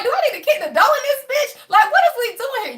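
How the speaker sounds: ready to kick the door in; mock-threatening.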